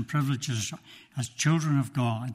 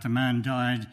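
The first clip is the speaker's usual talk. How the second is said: ordinary talk, nothing marked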